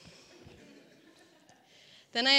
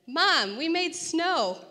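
put-on high, slow little-kid voice; drawn-out excited toddler announcing; child voice; 8 semitones higher, 5 dB louder, much slower than usual. Kids report making snow.

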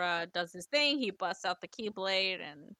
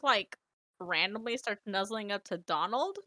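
ordinary talk

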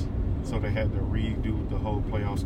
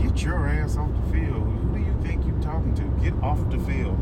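retold angry outburst; 6 semitones higher than usual. Retelling how the coach told Shelly off the field, mild angry outburst. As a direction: act out the coach snapping at Shelly without raising volume. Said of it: "he looks at Shelly and goes".

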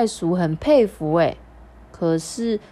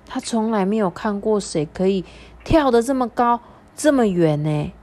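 ordinary delivery

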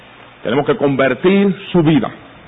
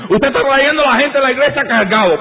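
much higher, needling accusation; high mock voice of a critic; 10 semitones higher than usual. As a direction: play a scoffing churchgoer pointing fingers.

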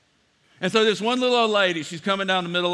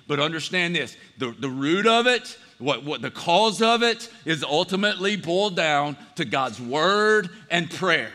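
ordinary delivery

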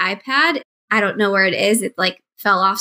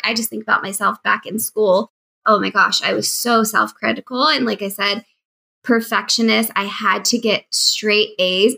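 usual speaking voice

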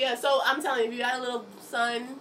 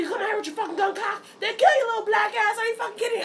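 much higher, fast, rising yell; furious scolding; 13 semitones higher, 7 dB louder, faster than usual. Retold or imitated. Mom yells at her son for the cocked gun, much higher and fast. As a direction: tear into him, scared and angry. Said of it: "I cussed him out".

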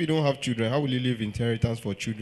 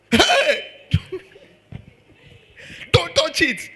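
sudden high shout, much higher and louder; wild shout; 8 dB louder, much slower than usual. Retold or imitated. God shouting at Eve over the tree, loud high mock yell. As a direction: bark it like a wild animal. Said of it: "shouted at Eve like a wild animal".

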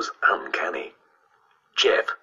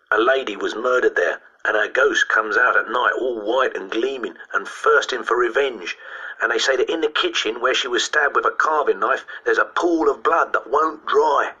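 put-on higher-pitched boy relishing a grisly tale; schoolboy ghost-story teller; 5 semitones higher than usual. Boy telling classmates about the haunted house, spooky relish. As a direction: play a boy spooking his classmates, dragging out the ending.

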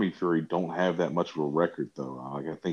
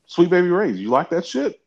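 higher, louder mock voice pushing a favorite product; Mark Zuckerberg; 11 semitones higher, 7 dB louder than usual. Mock billionaire pitching sauce to a visitor, high loud eagerness. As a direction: put on an eager, pitched-up questioner. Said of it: "And he goes".

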